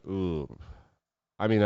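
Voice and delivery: ordinary talk, nothing marked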